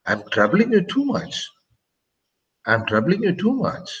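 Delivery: "much" lifted high like a question; statement asked as a question; rising question tone. Shown as a demonstration. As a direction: turn the statement into a question by pitching "too much" up. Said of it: "but by my tone, you understand that it's a question".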